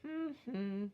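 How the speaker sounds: wordless two-beat hum; hummed syllables. Shown as a demonstration. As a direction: hum out both beats of the word. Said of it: "and then say".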